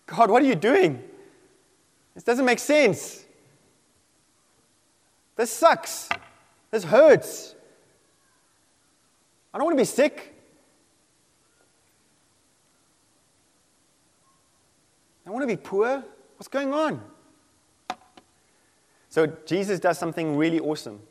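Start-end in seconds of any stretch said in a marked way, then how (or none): 0.1-7.5 higher-pitched protest to God; acted exasperated plea; pained complaint. 9.5-17.0 high, whiny-edged plea to God; acted bewildered protest; plaintive complaint.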